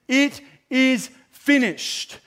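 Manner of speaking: drawn out and falling; solemn slow proclamation; slow, weighty declaration. Acted. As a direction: proclaim it slowly, word by word.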